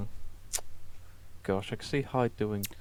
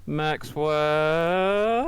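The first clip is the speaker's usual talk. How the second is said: drawn-out, rising higher-pitched call; 5 semitones higher, 4 dB louder than usual. Acted. Boss summons Maxwell, calling out long and high. Player's character calls out for Maxwell.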